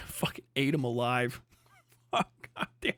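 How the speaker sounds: ordinary delivery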